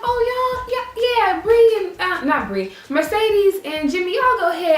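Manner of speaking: high-pitched, acted casual send-off; high, hesitant excuse to slip away; airy brush-off. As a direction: wave friends on in a high, put-on voice. Acts out sending friends on.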